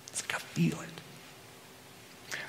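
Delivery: slow, breathless whisper of wonder; awed whisper. Real, not acted. Preacher on feeling the Lord's pleasure, whispered. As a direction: hush it like a secret.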